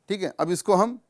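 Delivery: normal talking voice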